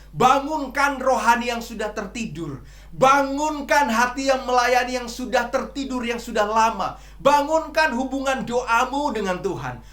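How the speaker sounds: loud, high, forceful sermon delivery; preaching at full force. Acted. (berkhotbah dengan lantang dan penuh semangat)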